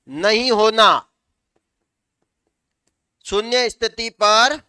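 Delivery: slow, measured dictation with long pauses; dictating. Real, not acted. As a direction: dictate slowly for students writing it down. Teacher dictating for students to write.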